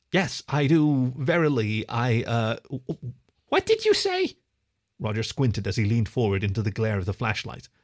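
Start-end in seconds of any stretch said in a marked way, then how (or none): 0.1-4.4 stammering, losing the thread; nervous kid tripping over words; flustered stammer. Acted. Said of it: "the fox stuttered".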